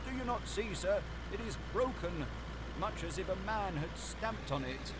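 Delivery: high, urgent pointing out; agitated butler. A narrator's reading. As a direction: press the point anxiously.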